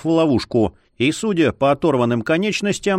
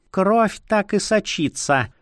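breathy vintage purr; 5 semitones higher, slower than usual. Acted. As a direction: purr it out slowly, coaxing. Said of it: "промурлыкала вампирша".

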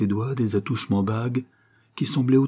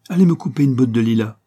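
louder, raised voice giving an order; 3 semitones higher, 6 dB louder than usual. A narrator's reading. Mistress to her chambermaid the next evening, ordering. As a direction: call out an instruction to a servant. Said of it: "ordonna-t-elle".

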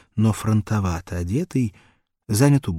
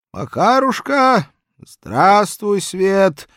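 put-on high, loud old man's greeting; 11 semitones higher, 7 dB louder, much slower than usual. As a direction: call out like a cheery old man.

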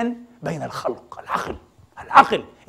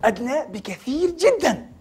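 a preacher mimicking an amazed scientist; astonished; 8 semitones higher than usual. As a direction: let the amazement lift the pitch. Scientist's astonished finding retold.

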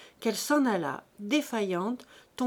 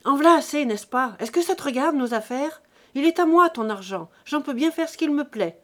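loud, higher angry retort; raised-voice indignant father; losing his temper; 6 semitones higher, 9 dB louder than usual. A narrator's reading. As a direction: snap back, fed up and cross. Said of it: "finissait par se fâcher".

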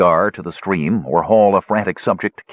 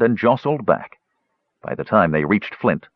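usual speaking voice

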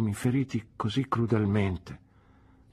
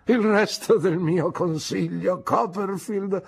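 higher, louder, slow pompous speaker; self-important gentleman giving counsel; Mr Micawber; 11 semitones higher, 4 dB louder, slower than usual. Acted. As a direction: lay it out grandly. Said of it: "proseguì mister Micawber".

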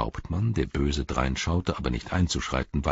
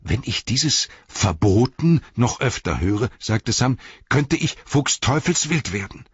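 higher and louder, fed up; exasperated Sam; 9 semitones higher, 6 dB louder than usual. Acted. Sam fed up with rules, exasperated. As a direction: grumble with mounting anger.

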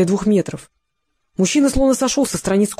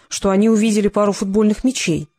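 ordinary delivery, nothing marked

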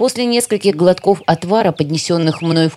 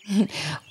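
snort of laughter; 4 semitones higher, 10 dB quieter than usual. A narrator's reading. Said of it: "я прыснула от смеха".

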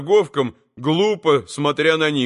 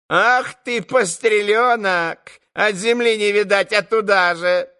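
scolding market woman; 7 semitones higher than usual. A narrator's reading. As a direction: tell off the little rascal, pitched high.

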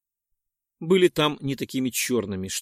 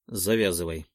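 calm firm stop; 6 semitones lower than usual. Acted. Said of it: "Медленно покачал головой и сказал".

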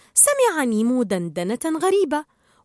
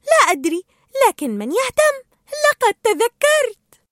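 high, loud, elated, last word stretched; carefree excitement; 11 semitones higher, 6 dB louder than usual. Acted. Dory shrugs off Marlin's question, excited crowing. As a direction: brush it off, then crow in delight.

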